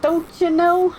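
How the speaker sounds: put-on a quick Midwest accent jab; upper Midwest accent. Streamer joking about accents, doing an upper Midwest accent.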